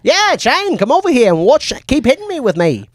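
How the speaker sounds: high, hammed-up invite; bad impression. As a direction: call Shane over, overdone. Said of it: "bad of an impression".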